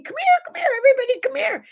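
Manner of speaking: put-on high-pitched excited child yelling; kid screaming. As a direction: shriek it like a little boy calling everyone. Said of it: "he started screaming".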